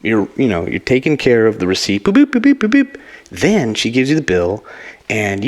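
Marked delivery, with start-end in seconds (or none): 2.0-3.0 high louder boops; beeping sound. Pretend. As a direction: beep like a checkout scanner. Imitating scanner beeps.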